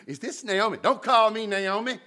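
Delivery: high, heated retort as bitter Naomi; Naomi snapping back. Acted. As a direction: fling it back at the women.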